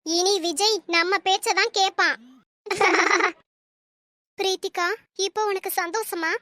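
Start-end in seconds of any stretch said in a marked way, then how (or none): 2.7-3.3 sly triumphant laugh. Acted. Schemer laughs after fooling son.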